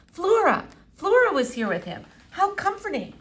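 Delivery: a hint of an old eccentric doctor; Doctor Measham. A narrator's reading. Old doctor peering at the squirrel, lightly foreign.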